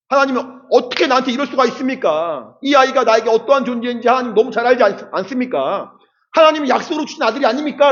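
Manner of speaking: a pleading, indignant Abraham; imagined complaint. Pretend. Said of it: "불평을 하고 불만을 하고 원망을". (하나님께 항의하는 아브라함, 높은 목소리)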